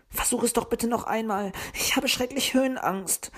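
pleading. A narrator's reading. Puh begs Wuschel to climb again. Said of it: "sagte Puh flehentlich".

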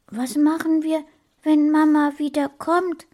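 hohe Kinderstimme, neugierig. A narrator's reading. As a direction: spiel den kleinen Jungen. Kind Sascha fragt. (hohe, neugierige Kinderstimme)

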